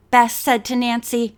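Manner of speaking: plain reading voice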